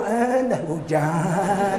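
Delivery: drawn-out, exaggerated moan of complaint; mock grumbling. Preacher mimicking people who complain about rain, mock grumbling. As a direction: draw it right out like a petty grumbler. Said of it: "Hujan ngeluh".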